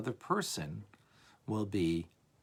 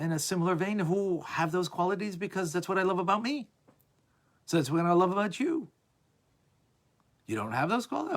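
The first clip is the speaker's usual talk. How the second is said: higher, louder hammed-up conditional love; mock self-centered lover; 8 semitones higher, 5 dB louder than usual. Teacher acts out selfish love for his class, hammed-up. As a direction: sneer out the terms of your love.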